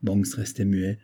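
usual speaking voice

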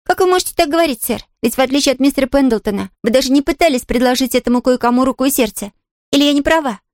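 put-on high astonished girl's question; surprised. A narrator's reading. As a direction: ask in wide-eyed surprise. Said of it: "удивлённо распахнула глаза".

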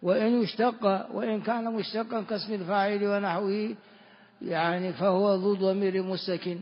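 normal talking voice

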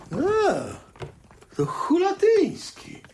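high and slow, caught off guard; surprised recognition. Acted. Husband spotting the neighbour at the door, startled. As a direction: stretch it out in dismay at who's coming.

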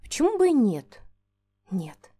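ordinary delivery, nothing marked

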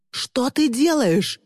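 high, drawn-out outraged cry; yelling. A narrator's reading. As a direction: wail it in outrage. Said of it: "завопил Тристин". (возмущённый вопль)

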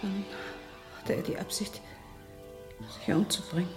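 hushed, high, halting voice; shaken realisation. Acted. Marge grasping that Dicky meant suicide, shaken and hushed. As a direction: let it dawn softly, with pauses.

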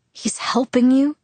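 a doubting question that climbs high at the end; dubious. A narrator's reading. To Jacob, doubting that Sam helps him, dubious rising question. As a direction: repeat it back, openly unconvinced. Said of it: "I repeated dubiously".

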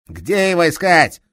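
angry detective shouting down a thief; enraged police inspector. Acted. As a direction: roar at the thief with fury.

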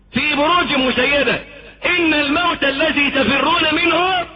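high, rising fervent preaching; preaching at full force. Acted. Preacher warns listeners of death, fervent high preaching. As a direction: build the warning to a climax.

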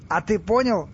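high-pitched menacing demand; threatening. Acted. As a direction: challenge them with a threat in the voice. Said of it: "с угрозой в голосе". (с угрозой в голосе)

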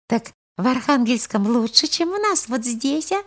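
lightly voiced curious old man. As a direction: ask gently as an old wanderer. Old holy fool asks girl.

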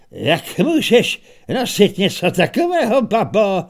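hlasitě a výš, vysmívej se; vytáhlý lupič křičí posměšně; hlasitý výsměch. Acted. (lupič s mečem hlasitě zesměšňuje babku)

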